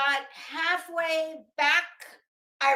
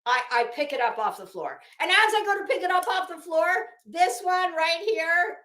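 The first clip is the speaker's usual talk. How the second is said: usual speaking voice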